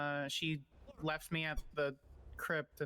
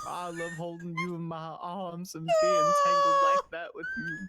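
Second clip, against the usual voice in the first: hammed-up Southern lover, swelling to loud and much higher; Southern accent; 10 dB louder than usual. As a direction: read the love text in a swooning Southern accent. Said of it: "I don't know why he's Southern".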